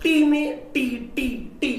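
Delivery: "टी" high, loud and punched each time; stress on टी. Shown as a demonstration. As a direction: hammer out "टी" as the stressed syllable. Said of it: "टी में stress दे रहे हैं". ("टी" पर ऊँचे सुर में पूरा ज़ोर)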